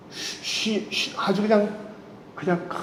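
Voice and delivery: whispered "쉬-", drawn out and soft; hushing whisper. Acted. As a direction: hush like lulling someone to calm. Acting out Jesus at rest.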